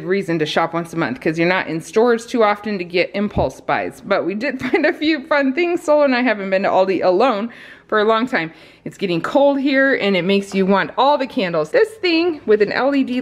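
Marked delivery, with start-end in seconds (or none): none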